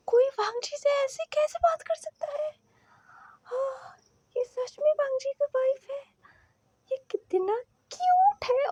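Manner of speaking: high, squealing fangirl gush; gushing inner squeal. Acted. Shu Yang gushing to himself over the couple, high squealing. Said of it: "उसने अपने मन में कहा".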